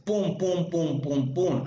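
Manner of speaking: imita los golpes de percusión con "pum, pum"; percussion taps. Shown as a demonstration. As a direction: golpecitos secos y rítmicos, "pum" cada uno. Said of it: "Al percutir generalmente se escucha así". ("pum, pum, pum" imitando el sonido de la percusión)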